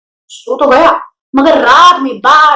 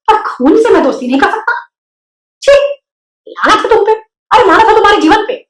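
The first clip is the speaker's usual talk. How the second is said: disgusted pig; 7 semitones higher than usual. A narrator's reading. Wilbur horrified, accusing spider.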